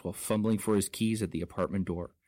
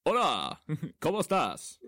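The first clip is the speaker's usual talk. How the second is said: put-on Spanish accent; 5 semitones higher than usual. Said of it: "His voice, it's so bendy".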